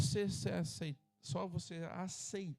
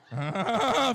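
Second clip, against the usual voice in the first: loud braying mock laugh, higher; imitated laugh; 5 semitones higher, 12 dB louder than usual. Pastor imitating his wife's laugh for the church, loud braying mock laugh. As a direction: bray it like a car stalling. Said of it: "Ela fica rindo".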